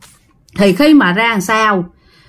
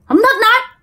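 very high, strange put-on voice, short and cold; possessed man's curt refusal; 13 semitones higher than usual. Possessed man repeats his refusal, very high and eerie. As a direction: refuse coldly, as the spirit would. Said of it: "Ổng nói vậy thôi đó các bạn".

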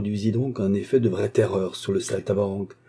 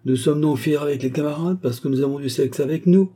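higher-pitched insolent taunt; 7 semitones higher than usual. A narrator's reading. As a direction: needle him with cheeky mockery. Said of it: "fit le serrurier d'un ton insolent". (ton insolent)

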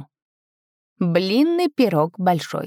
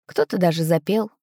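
usual speaking voice

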